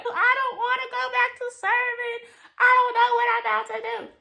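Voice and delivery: high, strained tearful wail; mock crying in the car; crying despair.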